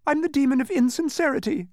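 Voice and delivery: very high whimpering wail; sobbing. Acted. Exposed demon confessing to Milo, high sobbing. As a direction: wail the confession in a squeaky pitch. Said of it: "he sobbed".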